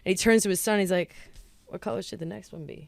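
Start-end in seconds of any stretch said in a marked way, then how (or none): 1.6-2.9 soft, nonchalant dad imitation; no big deal. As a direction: toss it off as if nothing happened. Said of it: "like, no big deal".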